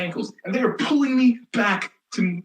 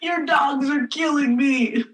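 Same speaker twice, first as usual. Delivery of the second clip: strained plea; 8 semitones higher, slower than usual. Retold or imitated. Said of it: "And I'm like".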